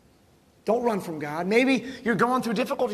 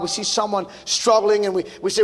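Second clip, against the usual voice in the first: usual speaking voice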